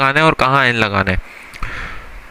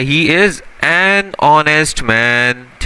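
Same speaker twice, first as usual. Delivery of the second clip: slow example read; much slower than usual. Shown as a demonstration. Teacher rereading the example sentence slowly. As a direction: spell it out word by word, unhurried. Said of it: "अब देखो ये क्या लिखा हुआ है".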